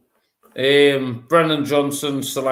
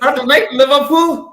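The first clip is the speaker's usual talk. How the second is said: high, loud and laughing in disbelief; 12 semitones higher, 7 dB louder than usual. Real, not acted. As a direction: laugh it out, can't believe it. Incredulous laughing at kickoff time.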